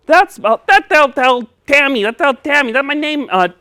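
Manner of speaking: high, loud, stumbling puppet with T for S; getting excited. Pretend. Puppet spotting his own name, excited and lisping. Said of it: "you're getting excited again".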